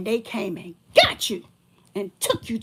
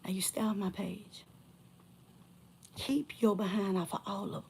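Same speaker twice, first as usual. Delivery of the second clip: whispered threat; 9 dB quieter than usual. Real, not acted. Whispered warning.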